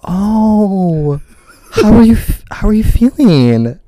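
high, hesitant concern; imitating a wary friend's sympathy. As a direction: draw out a careful, pitying question. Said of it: "looked at me and he was like".

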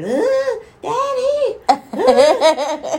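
put-on high whiny toddler cry; crybaby boy. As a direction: whine it like a clingy toddler. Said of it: "He was daddy's boy".

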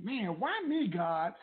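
high-pitched mild plea; acted questioning of God. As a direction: plead upward as the betrayed spouse. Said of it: "you might be saying".

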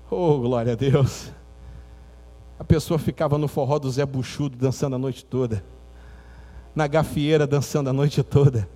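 usual speaking voice

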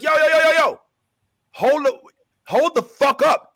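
loud, high, heated stop command; retold shout. Will yelling at the teammates to stop, loud and high. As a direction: bark it out to halt them.

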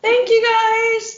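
high, loud excited thank you; excited shout. Real, not acted. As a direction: belt it out gleefully. Birthday thanks repeated, shouted.

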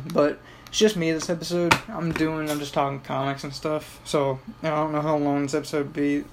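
normal talking voice

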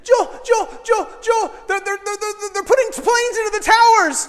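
high, loud, urgent shouting with stammered repeats; frantic child. Retold or imitated. Boy waking his houseguest with terrible news, frantic shout. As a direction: shout the alarm like a panicked kid.

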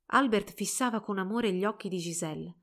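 ordinary delivery, nothing marked

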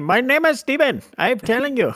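squeaky exaggerated Indian accent; mock Indian accent. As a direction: play the call center agent insisting on his fake name. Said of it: "they have a really thick Indian accent".

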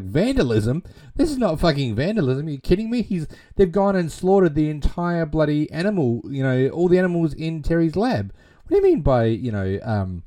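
high, loud, indignant rant; furious outburst. Retold or imitated. Teacher rages at the principal over the slaughtered lab animals, loud and indignant. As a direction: tear into him with disbelief.

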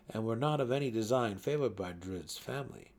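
ordinary delivery

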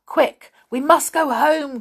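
high, loud storybook warning; urgent cry. A narrator's reading. Moonface warns friends to go home, urgent cry. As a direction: cry it out to hurry everyone. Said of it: "cried Moonface".

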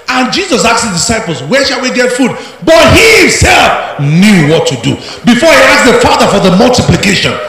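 loud, high, fervent preaching; preaching at full force. Real, not acted. Preacher shouting the Bible story to the congregation, fervent. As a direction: drive the story home, loud.

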